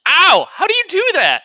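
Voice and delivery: sudden high loud ouch; pained yelp. Real, not acted. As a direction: yelp then ask in disbelief. Smacked own arm, pain.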